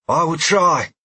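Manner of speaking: a steady vow close to his ear; fierce warrior woman. A narrator's reading. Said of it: "she said in my ear".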